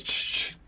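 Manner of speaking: two short hushing reload noises; imitated gun reload. Hero pretends to reload the gun, imitated reload sound. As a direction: mouth the sound of a gun being loaded. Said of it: "pretends to reload the gun and just goes".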